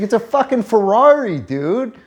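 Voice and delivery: high, loud indignant squawk; mock outrage. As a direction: play up the outrage at the buyer. Friend's indignant retort quoted.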